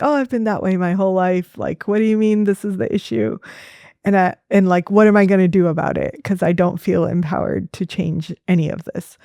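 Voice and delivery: ordinary talk, nothing marked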